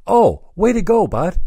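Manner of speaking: high, loud congratulation; retold dad's praise. Dad congratulating his son on the fixed phone, pleased. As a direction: imitate a delighted dad cheering you on. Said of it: "my dad goes to me".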